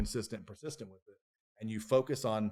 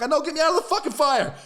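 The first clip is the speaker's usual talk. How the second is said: higher, louder, desperate shout; panicked yell; 11 dB louder, faster than usual. Retold or imitated. Man lying in the campfire yells at his friends in a panic. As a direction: yell to be pulled from the flames. Yelling to be pulled from the fire.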